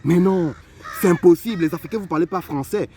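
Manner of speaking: put-on a girl gasping that it can't be true; incredulous girl. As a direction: play her stunned refusal to believe it. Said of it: "La fille me regarde".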